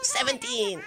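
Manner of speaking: very high, gleeful count; sneaky kid's tally. Retold or imitated. Kid counting his next victim, high and gleeful.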